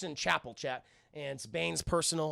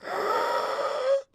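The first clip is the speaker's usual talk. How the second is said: long, loud labored gasps; drawn-out wheezing breath noise; ragged breaths; 4 dB louder than usual. Retold or imitated. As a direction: imitate rasping breaths in the dark. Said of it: "it's like these ragged breaths".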